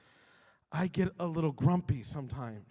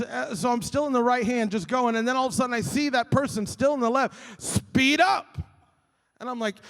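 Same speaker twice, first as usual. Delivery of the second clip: usual speaking voice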